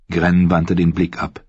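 plain reading voice

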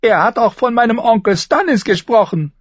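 high, loud whiny complaint; sulky boy king. A narrator's reading. Joffrey sulking to the council, high and whiny. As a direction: whine like a spoiled child.

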